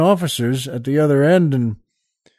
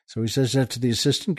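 ordinary delivery

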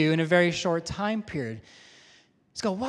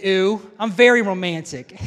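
louder, self-satisfied "Ooh, I'm very romantic"; a loud, cheeky brag to the wife; mock smug boast; 8 dB louder than usual. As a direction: puff yourself up as the proud husband. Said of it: "don't say".

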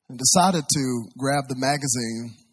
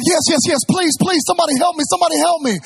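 very high, loud, frantic plea; desperate pleading; 8 dB louder, faster than usual. Retold or imitated. Passenger answering the voice on the radio, desperate pleading. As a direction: beg at a scream, acting out sheer panic. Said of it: "he hears on the radio a voice".

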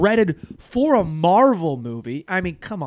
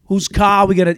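loud mock Boston accent, pitched up; 6 semitones higher, 10 dB louder than usual. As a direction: imitate a buddy's Boston accent, loud and high. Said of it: "it's literally his accent".

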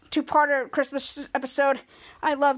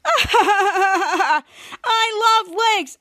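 higher, louder laughing cry; laughing shout; 5 semitones higher, 5 dB louder, much slower than usual. Retold or imitated. Human Krampus on the jet ski, laughing loudly. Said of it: "we saw human Krampus like".

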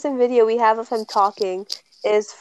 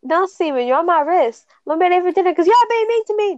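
put-on higher, fast mumbled Irish-accent gabble; 7 semitones higher, faster than usual. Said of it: "That's all I got".

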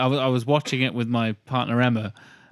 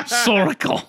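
laughing; 8 semitones higher, 4 dB louder than usual. Real, not acted. Laughing echo of the quote.

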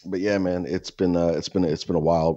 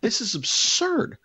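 high, slow mock disbelief; exasperated; slower than usual. Complaining to Dan about the neighbor, mock outrage. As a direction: stretch absurd out in disbelief.

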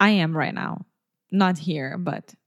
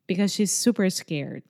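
normal talking voice

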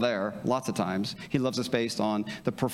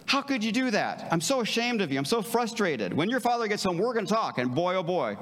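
higher, acted angry scolding; angry parent; 7 semitones higher than usual. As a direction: play the furious parent threatening a talk with dad. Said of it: "Third response is anger".

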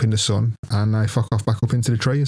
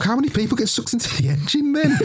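put-on high childish worry; 12 semitones higher than usual.

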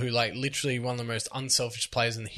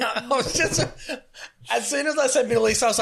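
laughing; 12 semitones higher than usual. Real, not acted. Laughing at his own slip.

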